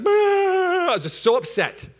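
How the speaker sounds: a high, loud, drawn-out fed-up bah; sulky cry of being overwhelmed; frustrated outburst. Retold or imitated. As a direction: let the bad day burst out. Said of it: "I was just like".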